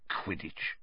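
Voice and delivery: unvoiced, falling mutter of "Quidditch"; muttered under breath. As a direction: say "Quidditch" to yourself under your breath, puzzled. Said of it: "he muttered under his breath".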